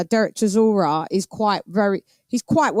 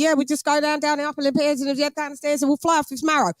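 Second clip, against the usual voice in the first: fast, higher-pitched East London geezer; sped-up East London accent; 6 semitones higher, much faster than usual. Shown as a demonstration. Londoner showing how film gangsters speed up, East London accent. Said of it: "they also speed it up more".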